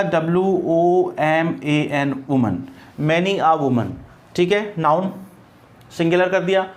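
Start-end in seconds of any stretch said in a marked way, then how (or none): 0.0-2.2 letters W O M A N spelled one by one, slow; teacher dictating each letter; spelled out slowly. Shown as a demonstration. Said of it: "इसकी जगह क्या हो जाएगा".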